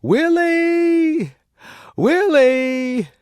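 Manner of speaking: very high, loud anxious calls; calling out. A narrator's reading. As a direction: shout into the dark caves. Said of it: "shouting into the dark passages".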